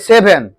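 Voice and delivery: "सेवन" said "seben", b for v; common mispronunciation. Shown as a demonstration. Teacher shows how people usually say it, common mispronunciation. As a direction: put a b in "सेवन". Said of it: "हम लोग कहते हैं सेवन".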